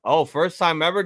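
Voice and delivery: ordinary talk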